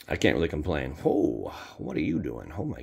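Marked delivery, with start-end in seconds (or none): none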